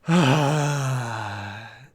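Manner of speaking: long loud weary sigh. Real, not acted. Reader of the dull order sighs out loud in exasperation. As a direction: heave a tired exasperated sigh.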